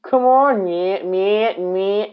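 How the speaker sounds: second try at the begging partner; Lio Rush impression. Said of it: "He's gonna sound like that".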